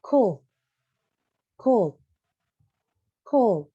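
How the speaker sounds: "cold" spoken loud and clear, short and falling, for repeating; model pronunciation of could. Shown as a demonstration. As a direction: drill the word "cold" for the class. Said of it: "Coba diikutin". (ucapkan "cold" dengan jelas dan tegas untuk ditiru)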